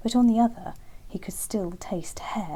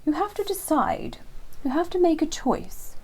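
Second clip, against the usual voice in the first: lightly raised wise hare voice; a hint of an eager old hare; Hare's voice; 8 semitones higher than usual. Hare voiced in bedtime story.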